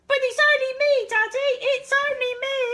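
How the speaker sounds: put-on high, loud, fast child's shout. Charlie shouting to daddy, high and fast. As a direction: squeal it out high and quick. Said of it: "As Charlie shouted".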